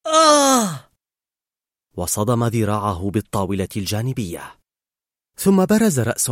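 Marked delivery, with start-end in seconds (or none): 0.1-0.9 high, loud scream; startled scream. A narrator's reading. Boy startled by balloon at his window, high loud scream. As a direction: cry out in sudden fright. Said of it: "فصرخ".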